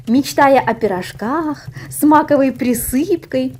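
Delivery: ordinary delivery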